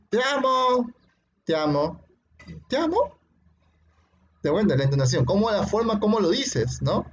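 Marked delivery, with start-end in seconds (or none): 0.1-0.9 "Te amo" pitched high and sustained; emphatic intonation. Shown as a demonstration. Teacher showing intonation to student, high emphatic "Te amo". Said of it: "Y la entonación también". 2.7-3.2 "te amo" rising very high, as a question; questioning intonation. Shown as a demonstration. Teacher contrasting intonations, questioning "te amo". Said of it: "Y la entonación también".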